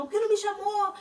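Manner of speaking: a sister's high, loud protest at being left out; sister's high complaint. Retold or imitated.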